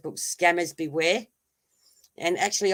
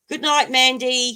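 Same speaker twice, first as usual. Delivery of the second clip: higher, slow, tender goodnight; sing-song goodnight to Mandy, higher and slow; 6 semitones higher, 5 dB louder than usual. Real, not acted. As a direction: croon good night like tucking her in. Saying goodnight to someone.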